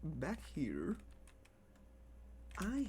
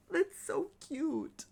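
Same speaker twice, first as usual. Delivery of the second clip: gushing; 12 semitones higher, 5 dB louder, much slower than usual. Real, not acted. Gushing over her new pet.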